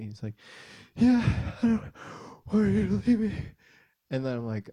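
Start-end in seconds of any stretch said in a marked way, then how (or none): 1.0-3.3 high, loud, broken-up mock sobs; sobbing little kid. Son admitting fear to dad, imitated sobbing. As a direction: imitate a child hiccuping words through tears.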